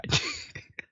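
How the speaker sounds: short high burst of laughter; a quick amused cackle. Real, not acted. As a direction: laugh out at the image. Reacting to crawling bull.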